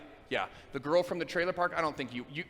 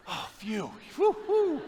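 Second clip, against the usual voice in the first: relieved sighs; 9 semitones higher, slower than usual. Pretend. Relief it did not happen.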